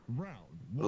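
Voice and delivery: announcer voice. Pretend. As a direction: announce it like a fighting game. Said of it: "let me do a voice".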